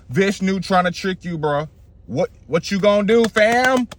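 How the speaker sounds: high-pitched challenge, climbing at the end; Shukra. Acted. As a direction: press the king, voice rising. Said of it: "This is Shukra".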